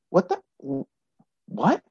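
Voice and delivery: the breath knocked out of him; shocked disbelief. Retold or imitated. Hearing his best friend died, stunned high soft what. Stepfather says David's dead.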